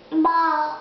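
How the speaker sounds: loud playful baa; sheep baa. Pretend. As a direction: bleat it like a sheep. Child imitates a sheep.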